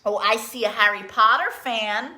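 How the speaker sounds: usual speaking voice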